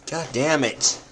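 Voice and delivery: irritated outburst; real frustrated curse. Real, not acted. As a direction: snap it out at the lost ring. His ring just flew off.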